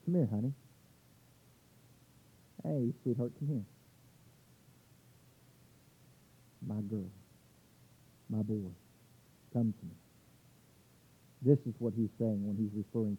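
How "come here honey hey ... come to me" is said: put-on soft, lower, coaxing call to a child; gently calling a child close, fading softer; tender parent. Parent softly calling their child over, tender. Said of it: "No, we don't do that".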